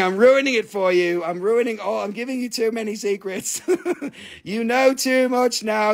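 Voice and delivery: normal talking voice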